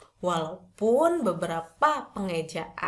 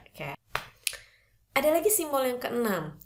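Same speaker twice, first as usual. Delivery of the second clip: ordinary delivery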